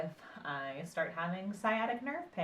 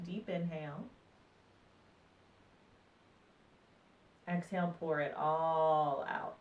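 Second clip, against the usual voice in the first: calm, unhurried meditation voice with long pauses; guided meditation. Acted. Meditation teacher guiding listeners through breath and body awareness, calm guided meditation.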